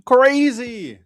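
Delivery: loud excited yelling about the clip; hyped up. Real, not acted. Streamer hyped at a clip, loud excited yell. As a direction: hype it up, voice jumping high and loud.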